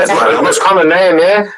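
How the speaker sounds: usual speaking voice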